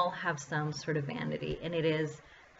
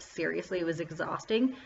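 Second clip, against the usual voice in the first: ordinary talk, nothing marked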